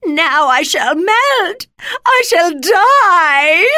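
high, loud, long-drawn wail; witch crying out. A narrator's reading. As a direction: wail as the dying witch, stretching the last word. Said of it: "she cried".

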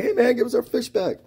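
very high-pitched retold yell; high, indignant mock holler; calling out demand. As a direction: call across the water for your catch. Acts out demanding stolen fish back.

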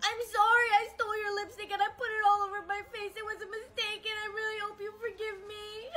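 rushed, tearful apology; frantic confession. Acted. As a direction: blurt out the apology, near crying. Frightened child confesses.

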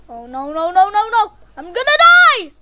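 rising from dismay to a high, loud shriek; panicked yell. Real, not acted. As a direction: build to a panicked scream. Panicking during game danger.

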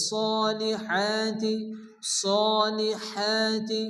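very slow সলিহাতি, long rounded so and long haa; chanted, drawn-out সলিহাতি; long vowel stretch. Shown as a demonstration. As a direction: hold the first vowel of সলিহাতি. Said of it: "এক আলিফ পরিমাণ টান দিয়ে".

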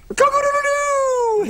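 high crowing rooster call. Pretend. Making farm noises for Ben, high rooster crow. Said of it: "I'm making farm animal noises".

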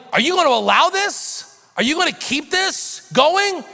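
high, loud mock outrage; outraged complaint. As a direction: play the scandalized leaders. Voicing the leaders' complaint.

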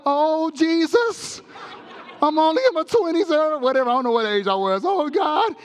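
high, laughing frightened wail; hammed-up terror; mock panic. Preacher retells his own fear on the plane, high mock panic. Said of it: "And I'm like".

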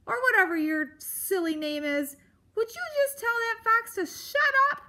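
high, loud exasperation; crying out. Acted. Grump orders mouse to silence the fox, yelling. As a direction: build to a shrieked order. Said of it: "Aerith cried".

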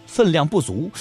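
ordinary delivery

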